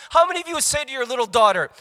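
ordinary delivery

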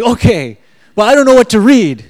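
high, very loud mock protest; hammed-up exasperated yell; exasperated surrender. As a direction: give in loudly, acting out exasperation. Exasperated giving in to inner voice.

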